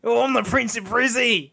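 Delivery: a rowdy Australian brag; Australian accent claiming to be prince. Retold or imitated. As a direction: boast it like a Brisbane lad. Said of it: "An Australian accent".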